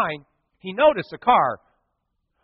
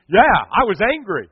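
ordinary talk

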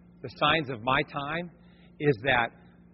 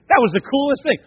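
excited; 10 semitones higher, 8 dB louder than usual. Real, not acted. Excited aside.

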